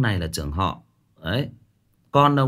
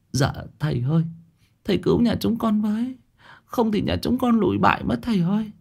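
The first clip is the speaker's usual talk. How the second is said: high, begging plea to the shaman; tearful pleading; 9 semitones higher, faster than usual. Acted. Lụa begs the shaman to save her family, high tearful plea. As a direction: cry out for rescue, voice pitched high. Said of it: "tiếng khóc lóc van xin".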